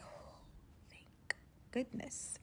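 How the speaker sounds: breathed-out, whispered sigh of relief; relieved whisper. Retold or imitated. Captive privately relieved as the car runs dry, whispered.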